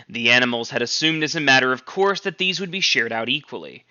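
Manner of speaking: plain reading voice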